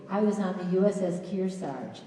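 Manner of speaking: ordinary delivery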